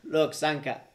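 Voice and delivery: loud, high Jamaican voice, starting to crack up; Jamaican accent. Acted. Actor fumbles the friend's name, loud Jamaican accent. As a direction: lose the Jamaican accent to laughter. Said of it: "I gotta do an accent and a character".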